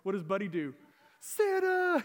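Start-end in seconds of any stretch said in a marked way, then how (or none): 1.2-2.0 high, loud squeal of pure delight; excited squeal. Retold or imitated. Pastor imitating Buddy the Elf hearing Santa is coming, ecstatic high shout. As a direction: shriek the name like a thrilled kid. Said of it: "He just freaks out".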